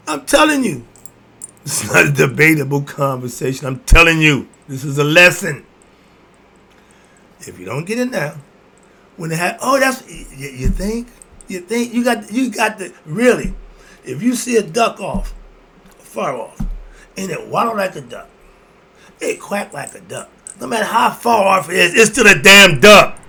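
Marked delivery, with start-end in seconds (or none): 0.1-5.6 forceful loud lecture; loud insistence. Real, not acted. Insisting during the rant. 20.6-23.2 real angry yell, high and loud; heated shout at the audience; agitated shout. Real, not acted. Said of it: "I'm getting agitated".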